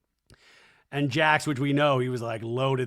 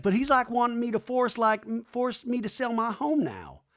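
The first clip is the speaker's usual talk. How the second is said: imitating Brittany; 9 semitones higher than usual. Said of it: "And Brittany's like".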